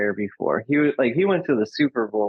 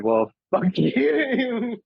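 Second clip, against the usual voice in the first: high, slow, laughing mock defiance; defiant mock retort; 10 semitones higher, much slower than usual. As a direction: drag it out defiantly through a laugh. Dad's defiant reply, retold.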